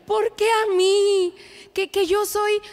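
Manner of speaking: a daughter in hospital pouting at her mom; dramatic child. Retold or imitated. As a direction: drag out the complaint like a pouting girl.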